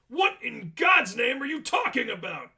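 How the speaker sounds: high, loud exasperated yell; baffled shout. Acted. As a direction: shout it, completely lost and furious. Manager baffled and angry.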